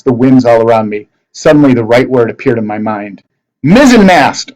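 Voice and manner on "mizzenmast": loud, high commanding shout; yell. A narrator's reading. Percy commanding the ship to sail, loud high shout. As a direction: call it out to the ship. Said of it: "I yelled".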